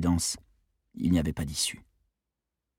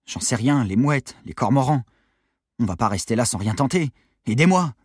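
ordinary delivery, nothing marked

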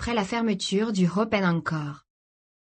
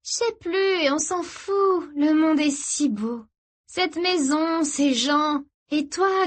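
very high, slow, rapturous; drugged wonder; euphoric sister; 9 semitones higher, slower than usual. Acted. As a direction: marvel at everything dreamily.

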